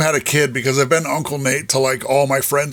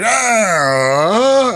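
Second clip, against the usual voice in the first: high drawn-out wail, imitating a child; cranky toddler whine; 7 semitones higher, 4 dB louder than usual. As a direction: mimic a cranky little kid's cry. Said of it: "when he's cranky and you FaceTime me".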